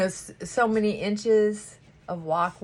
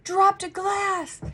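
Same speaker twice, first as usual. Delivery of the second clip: dramatic client; 10 semitones higher, 5 dB louder than usual. Retold or imitated. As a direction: ham up the client's overreaction. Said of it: "and thinking".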